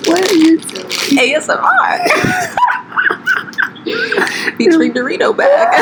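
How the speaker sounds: shouting through laughter; laughing loudly. Real, not acted. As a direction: crack up loud. Bursting into laughter at ASMR.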